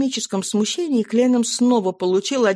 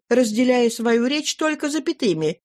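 plain reading voice

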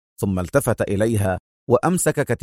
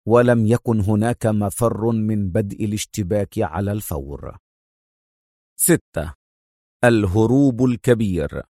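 usual speaking voice

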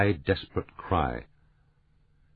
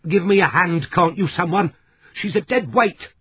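much higher and louder, strained plea; crying out; 12 semitones higher, 6 dB louder than usual. A narrator's reading. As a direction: call out for help under the weight. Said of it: "Missus Demery cried".

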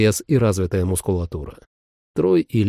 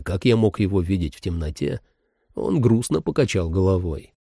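ordinary delivery

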